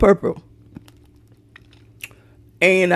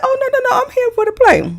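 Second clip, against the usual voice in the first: polite refusal; faster than usual. Retold or imitated. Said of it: "I said".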